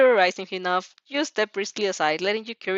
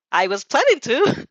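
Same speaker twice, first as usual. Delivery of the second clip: put-on childish mumble; 5 semitones higher, 6 dB louder than usual. A narrator's reading. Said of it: "he childishly mumbled".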